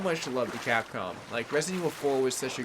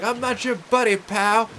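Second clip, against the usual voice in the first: mock retort; 7 semitones higher, 7 dB louder than usual. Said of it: "And I'm like".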